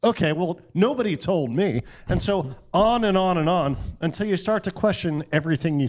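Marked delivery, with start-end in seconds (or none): none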